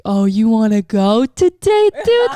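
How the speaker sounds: drawn-out, loud taunting call-out; hammed-up aggressive dare, pitch leaping up; mock fight challenge. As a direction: re-enact squaring up for a fight, stretching the words. Acts out challenging man to fight.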